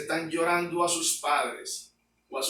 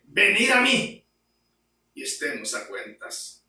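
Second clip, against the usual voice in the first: proclaimed quote; 3 dB louder than usual. Acted. Quoting God's call.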